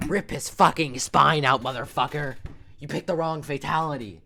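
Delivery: full-voice outraged demand; angry shout. Real, not acted. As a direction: hurl it at the screen in frustration. Repeating the wish, exasperated.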